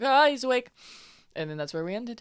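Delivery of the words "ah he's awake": excited. Retold or imitated. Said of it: "they're like".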